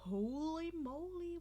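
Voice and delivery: ordinary delivery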